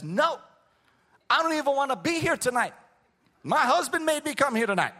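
high, loud, mad retort; high, loud, fed-up wife dragged to church; retold angry woman. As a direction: voice her as she snaps back in anger. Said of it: "I mean, she was mad".

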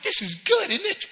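high amused question; high delighted aside. Retold or imitated. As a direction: lift it high like a happy surprise. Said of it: "I was like".

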